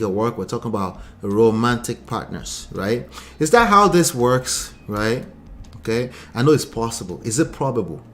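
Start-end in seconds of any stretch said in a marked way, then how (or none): none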